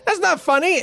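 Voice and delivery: high, put-on voice of an irritated bandit; annoyed guard. Bandit scolding his laughing partner, high annoyed voice. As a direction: snap at your laughing buddy in a high, odd voice. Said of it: "looks at him and says".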